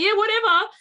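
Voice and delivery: high, loud careless yeah whatever; dismissive brush-off. Retold or imitated. Parent brushing off kids, high and loud. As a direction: wave it away to get rid of them.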